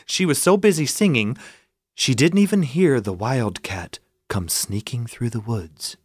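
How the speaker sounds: ordinary delivery, nothing marked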